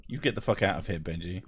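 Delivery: usual speaking voice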